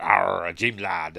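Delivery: drawn-out mock pirate growl; slow, hammed-up West Country pirate; pirate talk. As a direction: imitate an old film pirate, stretching the Arr. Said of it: "invented the pirate talk".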